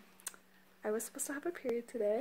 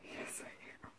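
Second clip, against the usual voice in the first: whispering; 16 dB quieter, faster than usual. Real, not acted. Excited whispered insistence.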